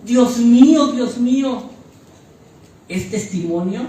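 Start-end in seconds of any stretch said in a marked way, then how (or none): 0.0-1.6 loud call to God, then easing; a heartfelt cry for help, first call loudest; clamor del corazón. Acted. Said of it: "de tu corazón sale un". (clamor de auxilio a Dios)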